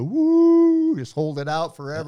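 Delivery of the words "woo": high drawn-out howl, loud; wolf howl. Retold or imitated. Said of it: "and I go".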